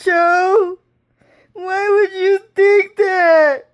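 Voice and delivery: crying shout. Retold or imitated. Retelling what he screamed at Cho, high crying shout. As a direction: wail it out through tears at the top of the voice.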